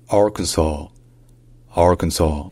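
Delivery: Arkansas said "AR-kən-saw"; American English pronunciation. Shown as a demonstration. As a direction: stress the first syllable of Arkansas, final s silent. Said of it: "ça se dit".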